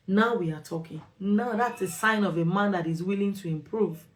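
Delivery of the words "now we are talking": normal talking voice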